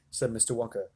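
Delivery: plain reading voice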